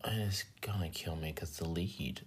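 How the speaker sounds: breathy frustrated whisper. Real, not acted. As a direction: hiss it under your breath. Frustrated aside, unvoiced.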